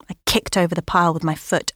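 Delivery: ordinary delivery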